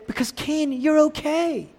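high-pitched, coddling reassurance; mock reassurance. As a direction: soothe him in an exaggerated high voice. Mimics reassurance God does not give.

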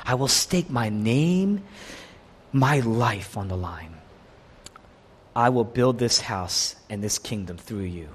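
low, slow, weighty pledge; solemn vow. Acted. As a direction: swear it gravely, taking your time. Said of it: "it's a solemn vow".

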